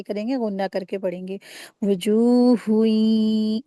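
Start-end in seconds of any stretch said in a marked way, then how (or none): 1.8-3.6 slow drawn-out tajweed recitation, louder; Quran recitation demo. Shown as a demonstration. Teacher demonstrating recitation to students, slow and drawn out. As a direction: recite it slowly like a qari. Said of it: "इस तरीके से".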